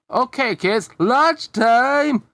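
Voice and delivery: cheery loud playground announcement; teacher calling out. A narrator's reading.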